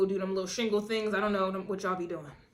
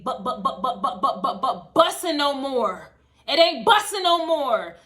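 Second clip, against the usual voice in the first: mock stammer building; 3 semitones higher, 8 dB louder, slower than usual. Mocking stammer building to punchline.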